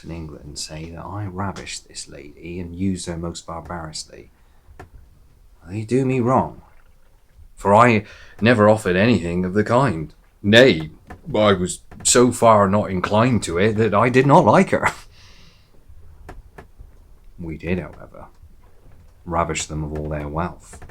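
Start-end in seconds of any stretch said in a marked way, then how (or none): 5.7-14.9 loud, emphatic protest of innocence; affronted storyteller, voice raised; indignant denial. Acted. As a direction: protest the accusation forcefully. Pirate denies ravishing the lady.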